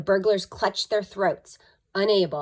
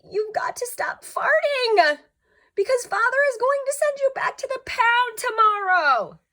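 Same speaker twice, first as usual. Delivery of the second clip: put-on very high, louder child voice; pleading Billy; 6 dB louder than usual. A narrator's reading. Billy warning Walter about the pound. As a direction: beg the dog to behave. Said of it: "said Billy".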